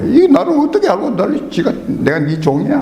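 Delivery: high-pitched offended retort, acted out; mock indignation. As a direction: act out a pastor bristling at being ordered around. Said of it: "굉장히 기분 나쁘다고". (기분 나쁜 척 발끈하는 말투)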